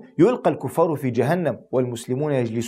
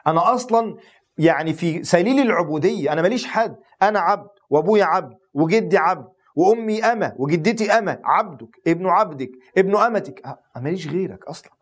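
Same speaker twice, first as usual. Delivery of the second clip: higher pitched fervent pleading; impassioned prayer; 4 semitones higher than usual. Acted. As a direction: pour it out as a servant begging his Lord.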